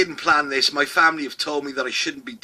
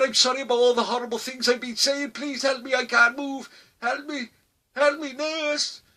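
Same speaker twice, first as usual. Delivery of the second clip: put-on high, whiny, panicked old man begging; helpless pleading; 11 semitones higher than usual. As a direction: plead for help, stuck on the floor. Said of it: "He's like".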